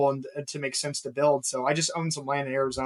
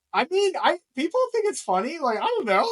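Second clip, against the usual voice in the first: squeaky, much higher voice, defending himself; high defensive whine; 12 semitones higher than usual. Retold or imitated. Husband answering his wife's critique, high and sheepish. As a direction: pitch way up and plead that people laugh. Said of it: "I was like".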